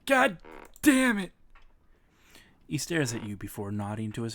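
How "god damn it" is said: ordinary talk, nothing marked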